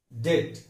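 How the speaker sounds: short, careful "det" for डेट; correct pronunciation. Shown as a demonstration. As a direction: say डेट without any b. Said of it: "इसका सही उच्चारण".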